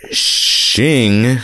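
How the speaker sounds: high drawn-out shing; Shing sound effect. Retold or imitated. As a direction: claws out with a shing. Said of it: "mixing of Wolverine and Wayne's World".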